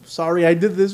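ordinary delivery

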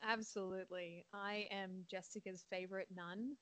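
ordinary talk

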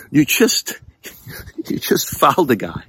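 laughing in disbelief. Real, not acted. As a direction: laugh through the words. Laughing at the obvious foul.

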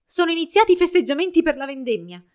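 put-on high, loud excited child; little girl shouting. A narrator's reading. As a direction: announce the festival like a thrilled small girl. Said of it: "gridò una bambina".

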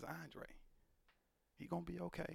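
hushed, gentle promise; soft reassurance. Retold or imitated. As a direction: say it softly to comfort. Stranger comforting, retold again.

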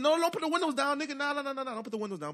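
high, frantic protest; rapid-fire panicked pleading, easing off at the end; panicking. Retold or imitated.

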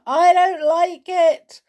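high, loud, drawn-out wail; frightened toy's high whine. A narrator's reading. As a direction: wail it miserably, stretching the words. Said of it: "wailed Sailor".

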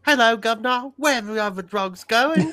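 loud, high mock British accent. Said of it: "I'll try British".

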